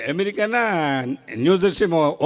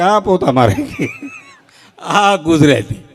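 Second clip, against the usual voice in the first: slow, loud, laughing through the words; laughing punchline; 6 semitones higher, 9 dB louder, much slower than usual. Real, not acted. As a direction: crack up on the punchline. (હસતાં હસતાં ધીમે ધીમે પંચલાઇન)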